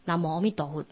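softly recited in Taiwanese Hokkien; Taiwanese pronunciation. Shown as a demonstration. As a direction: say the Buddha's name in Taiwanese, softly. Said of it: "你要念台语也可以".

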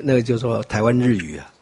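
ordinary talk

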